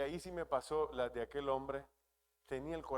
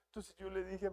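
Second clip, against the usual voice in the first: normal talking voice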